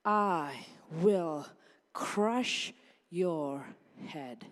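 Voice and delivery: slow, deep divine threat to the serpent; God's stern voice. Retold or imitated. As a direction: speak as God judging the serpent. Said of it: "he told the serpent".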